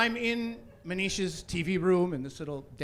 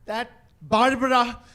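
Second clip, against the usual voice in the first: slow, high, loud indignation; mother fuming; 6 semitones higher, 7 dB louder, slower than usual. Acted. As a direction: hold back the swearword, furious, word by word. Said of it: "she was just fuming".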